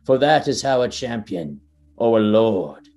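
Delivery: grand, measured trailer voice, slowing into the last words; epic trailer narration. Acted. Podcaster reading the Elden Ring trailer aloud, epic narration. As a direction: narrate it like a fantasy game trailer.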